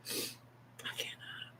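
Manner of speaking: "I cannot" slowly, in a barely voiced tearful whisper. Real, not acted. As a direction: breathe "I cannot" out slowly as the tears come. Repeats refusal in a whisper.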